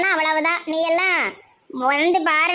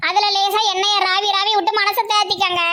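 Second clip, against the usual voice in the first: ordinary talk, nothing marked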